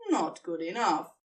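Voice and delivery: ordinary talk, nothing marked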